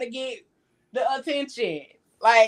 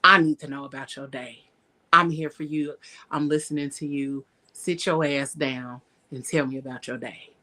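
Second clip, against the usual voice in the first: low, calm, commanding; lightly voiced commanding man; calm dominant man; 7 semitones lower than usual. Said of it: "he's saying it like".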